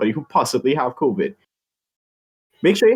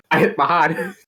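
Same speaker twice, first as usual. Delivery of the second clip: normal talking voice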